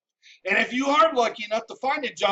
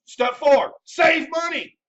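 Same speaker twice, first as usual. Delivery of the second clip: loud, high shout announcing the step; 5 semitones higher, 4 dB louder, slower than usual. Acted. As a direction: bellow the next rule like a drill sergeant. Ranting list, announcing step four.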